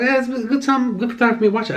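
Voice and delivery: upbeat. Retold or imitated. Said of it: "I was like".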